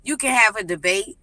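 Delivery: normal talking voice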